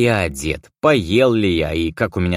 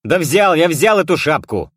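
getting worked up, louder; irritated shout; 9 semitones higher, 5 dB louder than usual. A narrator's reading. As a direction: snap back in irritation. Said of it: "заводясь, крикнул я".

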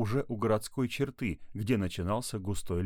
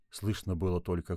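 ordinary delivery, nothing marked

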